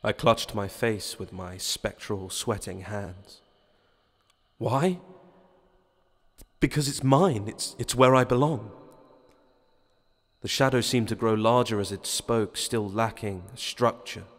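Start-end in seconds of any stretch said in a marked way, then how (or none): 4.6-8.7 higher, louder anguished plea; stumbling, desperate insistence; pleading. Acted. Hero pleads for his body.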